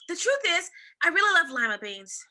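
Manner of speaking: loud, high blurted admission; loud confession. A narrator's reading. Camilla confessing to the old woman, loud and high. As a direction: blurt out the truth. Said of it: "she cried".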